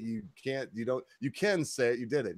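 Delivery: normal talking voice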